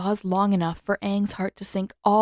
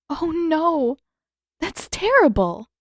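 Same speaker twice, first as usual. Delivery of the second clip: mock dismay; 9 semitones higher, 4 dB louder, slower than usual. A narrator's reading. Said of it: "betrayed the smile underneath".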